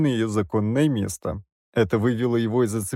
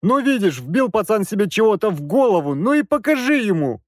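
much higher, louder, fed-up dad; exasperated father; 10 semitones higher, 4 dB louder than usual. A narrator's reading. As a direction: push him, at the end of your rope. Father pleading, irritated.

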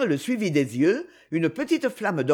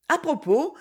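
lively cry, pitched higher; eager exclamation; 8 semitones higher than usual. A narrator's reading. Little girl eagerly cutting in on her governess. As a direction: burst in eagerly. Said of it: "s'écria vivement Isabelle".

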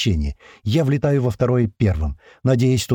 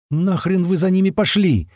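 high, raised-voice reproach; irritated yelling; 8 semitones higher than usual. A narrator's reading. Commander scolding his men in the dust, raised yell. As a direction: tell them off, voice pitched up. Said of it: "орёт, отплёвываясь от пыли Туман".

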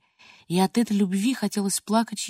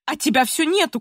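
higher, mildly raised scolding cry; understated shout of complaint; grandmother crying out; 6 semitones higher, 3 dB louder than usual. A narrator's reading. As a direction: call out in reproach as the grandmother. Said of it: "кричала бабушка".

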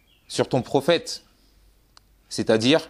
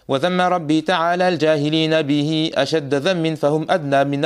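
higher-pitched, sustained measured Arabic verse recitation; poem recitation; 5 semitones higher than usual. Real, not acted. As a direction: recite the verses in an even, raised cadence. (إلقاء منظومة شعرية بنبرة مرتفعة)

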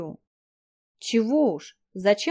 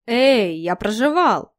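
indignant; 5 dB louder, slower than usual. Acted. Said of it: "возмутился он".

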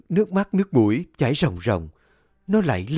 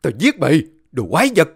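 yell; 5 semitones higher, 5 dB louder, faster than usual. A narrator's reading. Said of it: "rồi hét lên".

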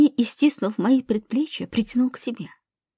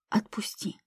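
very soft whispered plea; desperate whisper; 11 dB quieter than usual. A narrator's reading. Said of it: "прошептала отчаянно".

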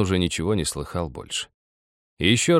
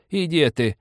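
put-on old woman asking; 10 semitones higher than usual. A narrator's reading. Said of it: "всё время спрашивая".

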